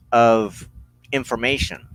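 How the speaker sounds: ordinary talk, nothing marked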